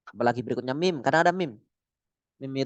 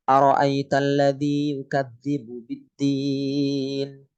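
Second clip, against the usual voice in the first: slow, flat tajwid recitation; Quran recitation; much slower than usual. Shown as a demonstration. Teacher demonstrating plain tajwid recitation to class, slow chant. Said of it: "Contohnya ini".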